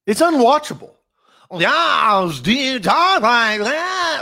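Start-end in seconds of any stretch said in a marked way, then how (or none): 1.5-4.2 higher, slowed, put-on TV interviewer voice; mock Adam Schein impression. Cohost mocking a TV host's fake delivery, put-on voice. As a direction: mimic a stiff, fake broadcaster delivery. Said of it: "your bad impression of him".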